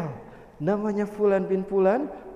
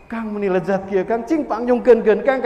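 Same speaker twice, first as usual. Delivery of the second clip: louder urgent craving plea; begging wife; 8 dB louder than usual. Acted. Pregnant wife begs husband, loud craving plea. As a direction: plead for the smell of food.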